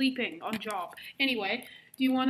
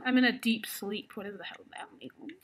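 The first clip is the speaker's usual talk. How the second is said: ordinary talk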